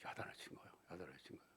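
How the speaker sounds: very soft whispered echo. Real, not acted. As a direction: drop to a breath. (속삭이듯 조용히)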